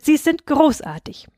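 high, delighted praise; enthusiastic. A narrator's reading. Husband praising his bride, enthusiastic. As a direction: praise her with rising excitement. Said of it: "rief er begeistert".

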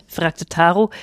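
usual speaking voice

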